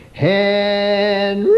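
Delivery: high drawn-out call of a name; mother calling. Retold or imitated. Mother calling her son from afar, high ringing call. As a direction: call him home from across the yard. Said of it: "she would call me something like this".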